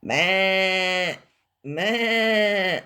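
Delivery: long, held "maa"; sheep bleating. Acted. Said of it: "And Sheep went".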